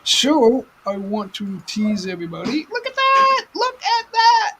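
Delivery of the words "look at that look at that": squealed high and loud; teasing excitement. Pretend. Showing viewers the wheel, in a squealing teasing voice. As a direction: tease them, voice way up.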